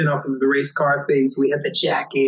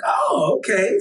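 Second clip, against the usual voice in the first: high-pitched "oh", easing down on "okay"; higher, lightly acted surprise; retold dawning realization; 7 semitones higher, slower than usual. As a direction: mimic their pleasantly surprised reaction. Retold reaction on learning name.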